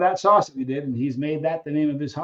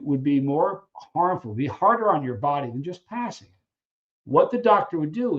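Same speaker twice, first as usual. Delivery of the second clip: ordinary delivery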